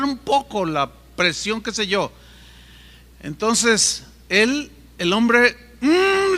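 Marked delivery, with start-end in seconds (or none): 5.8-6.4 high, insistent hum with mouth shut; urgent muffled hum. Retold or imitated. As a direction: hurry him without words. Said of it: "le hacía como que se diera prisa". (murmullo urgente sin abrir la boca)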